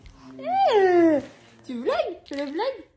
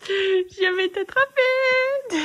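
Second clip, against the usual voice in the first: high, loud, slow teasing; mock-menacing playful tease; playful threat; 10 semitones higher, 7 dB louder, much slower than usual. Real, not acted. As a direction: drag it out like a chase game. Teasing chase game.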